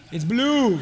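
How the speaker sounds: chuckling as he repeats it; father amused by his son's answer; laughing. Real, not acted. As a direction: laugh through the words.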